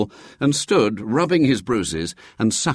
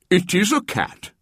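higher, pleased announcement; triumphant bishop; 10 semitones higher than usual. A narrator's reading. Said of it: "said the bishop in triumph".

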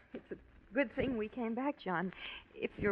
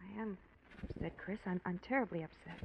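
hushed upset voice; quiet distress; 4 semitones lower, 9 dB quieter than usual. Acted. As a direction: speak low, near tears.

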